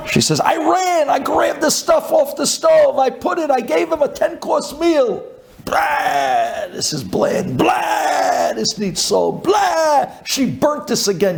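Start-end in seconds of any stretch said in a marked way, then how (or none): 0.4-5.2 high, urgent retelling; frantic wife. Retold or imitated. Wife recounts rushing to feed her husband, frantically. Wife's frantic retold account. 5.7-11.4 high cries of disgust, dropping between; disgusted complaining. Retold or imitated. Husband rejects his wife's cooking, disgusted. Husband rants about the food.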